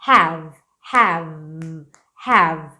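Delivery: "Have" low, ending firmly on v; correct have. Shown as a demonstration. Teacher drilling "Have" with no final vowel. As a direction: repeat "Have" ending cleanly on v. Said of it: "termina no v".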